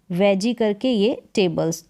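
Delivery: वेजी and टेबल्स said as two separate parts; word divided up. Shown as a demonstration. Teacher dividing a big spelling for pupils, वेजी and टेबल्स pronounced apart. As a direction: split it into वेजी, then टेबल्स. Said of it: "ऐसे divide कर कर pronounce कर सकते".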